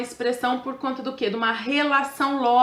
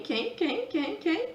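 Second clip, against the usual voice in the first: soft, rising, repeated higher; playful echoing question; teasing repeated question; 7 semitones higher, 6 dB quieter, much slower than usual. Acted. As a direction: coax the class playfully. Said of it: "teasing repeated question".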